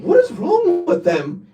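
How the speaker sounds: high-pitched, drawn-out exasperation; real baffled outburst; incredulous. Real, not acted. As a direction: ask it slowly in disbelief, pitch way up. Incredulous reaction to women's answer.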